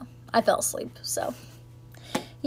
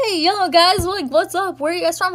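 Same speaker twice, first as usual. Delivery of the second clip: higher, louder friendly hail; excited call-out; 8 semitones higher, 3 dB louder than usual. Retold or imitated. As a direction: call over to the strangers, pumped up. Calling out to Irish strangers.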